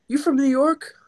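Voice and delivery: squeaky high impression of a friendly officer; imitating the officer. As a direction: play up the Brooklyn cop's delight. Cop friendly at checkpoint.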